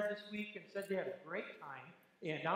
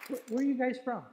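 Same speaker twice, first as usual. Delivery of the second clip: calling out; 6 semitones higher, 8 dB louder than usual. Real, not acted. Calling out to visitors in pew.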